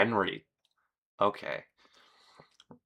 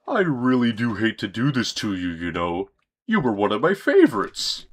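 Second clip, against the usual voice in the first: villain voice. Acted. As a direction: sneer at him like a cartoon villain.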